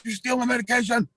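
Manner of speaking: high-pitched mock shout; acted-out furious yell; outraged accusation. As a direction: imitate a friend catching a thief red-handed. Friend catches him stealing pills.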